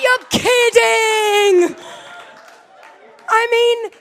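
a slow, loud "You're kidding" in horror; shocked disbelief. Retold or imitated. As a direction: stretch it out in stunned disbelief. Shocked at friend's confirmation.

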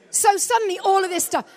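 ordinary delivery

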